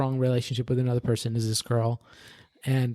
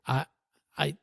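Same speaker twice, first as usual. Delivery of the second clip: usual speaking voice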